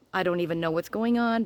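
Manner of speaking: normal talking voice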